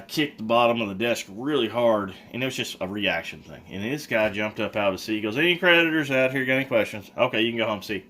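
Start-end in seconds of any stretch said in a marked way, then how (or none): 5.4-8.0 louder and higher, suddenly snapped awake; startled, jolted awake. Retold or imitated. Trustee jerked awake by the kicked desk, blurting loud.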